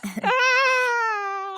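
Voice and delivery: laughing. Real, not acted. Laughing at own pun.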